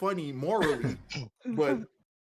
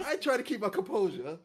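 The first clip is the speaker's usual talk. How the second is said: ordinary talk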